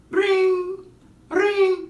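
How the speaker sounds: high sung ringing noise; phone ring. Retold or imitated. Calling her friend, high ringing imitation. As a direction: chirp the ring tone.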